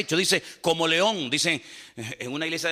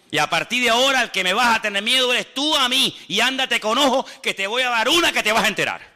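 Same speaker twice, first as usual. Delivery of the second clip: louder and higher, telling the devil off; raised voice scolding the devil; defiant rebuke; 6 semitones higher, 4 dB louder than usual. Acted. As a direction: warn the devil with force.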